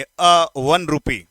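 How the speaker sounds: each word slowly and clearly, stressing अ; slow teaching demo. Shown as a demonstration. Teacher slowly demonstrates the article to his students. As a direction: spell it out slowly for students, leaning on अ. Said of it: "एन तो नहीं लगाएंगे".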